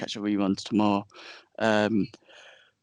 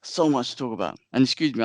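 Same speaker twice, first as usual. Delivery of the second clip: ordinary talk, nothing marked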